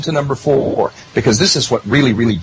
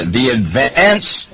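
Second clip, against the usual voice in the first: slow, weighty "the advance", leaning hard on "advance"; stress on advance; much slower than usual. Real, not acted. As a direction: drag out "the advance" and press down on "advance". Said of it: "and I emphasize advance".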